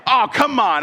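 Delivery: half-playful exasperated whine; mock grumpy groan. Husband grumbling at wife over her answer, mock exasperated. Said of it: "I'm, like, grumping at my wife".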